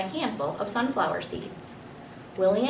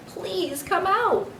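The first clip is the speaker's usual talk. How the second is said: pleading; 12 semitones higher, much slower than usual. Acted. Man begs hidden hamster.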